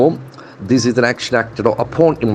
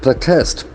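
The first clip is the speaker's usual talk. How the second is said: accent the second syllable of Protest; verb stress. Shown as a demonstration. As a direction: Protest with the beat on TEST. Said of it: "accent for the second syllable".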